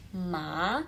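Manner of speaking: "ma" rising straight up; rising second tone. Shown as a demonstration. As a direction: swing MA upward like a question. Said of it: "nada dua".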